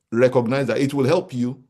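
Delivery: ordinary talk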